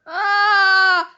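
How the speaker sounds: loud, high held scream. Real, not acted. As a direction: scream out pent-up fright. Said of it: "Je crie déjà".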